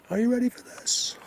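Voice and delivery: excited call-out. Real, not acted. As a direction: throw the question out, thrilled. Teasing viewers before the reveal.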